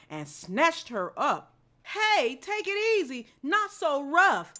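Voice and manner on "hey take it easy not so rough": high, loud yelp of protest; barked protest. Acted. Dog grabbed off the street protests, barking loud. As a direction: bark it out at the rough hands. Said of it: "Dottie barked".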